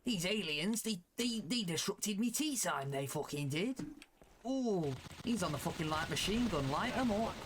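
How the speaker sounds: hammed-up British trooper with a grievance; British soldier. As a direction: play a cheeky British squaddie in battle. Plays British soldier in game.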